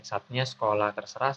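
usual speaking voice

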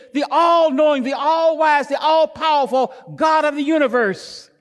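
loud, high shouted climax; preaching shout. Acted. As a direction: cry out each name of God. Climax of sermon, shouting attributes.

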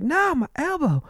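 very high-pitched mock victim; [name] faking pain. Injured passenger naming what hurts, fake pained voice. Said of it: "this seems fake as fuck".

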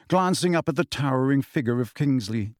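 usual speaking voice

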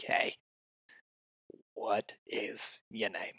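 low, slow, soft put-on greeting; hushed, deliberate mock introduction; awkward inner approach voice. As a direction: play a hesitant pickup line, drawn out and low. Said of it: "I won't say it like that".

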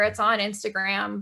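usual speaking voice

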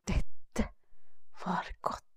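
whispered sigh of comfort; contented whisper. Acted. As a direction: breathe it out, cosy and sleepy. Sleepy contentment in the hut.

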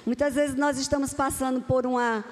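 usual speaking voice

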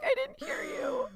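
high, slow coy voice; mock coy fishing for compliments. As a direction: play dumb to hear it again. Teasingly asking to hear praise again.

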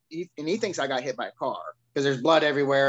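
ordinary talk

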